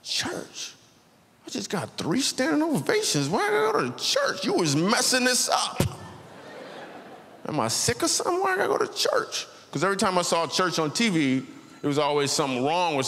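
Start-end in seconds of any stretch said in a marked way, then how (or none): 0.0-0.7 stunned whisper. Retold or imitated. Shocked reply to church invite. 1.5-9.5 pitched high, incredulous and whiny; outraged high protest. Retold or imitated. To the manager, high incredulous protest at a church invite.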